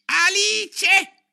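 very high, loud rabbit screech; shrill little voice. A narrator's reading. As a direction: read it out in a piercing squeak. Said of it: "con la sua vocina acuta e stridente". (vocina acuta e stridente)

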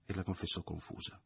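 ordinary delivery, nothing marked

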